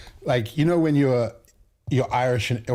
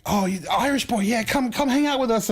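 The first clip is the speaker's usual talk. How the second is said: welcoming Irish mates; 12 semitones higher than usual. Retold or imitated. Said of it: "they were like".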